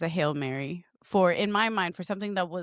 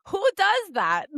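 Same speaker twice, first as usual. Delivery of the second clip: high, loud disbelief; incredulous high; 12 semitones higher, 4 dB louder, slower than usual. Retold or imitated. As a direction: squeak out the disbelief. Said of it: "I'm like".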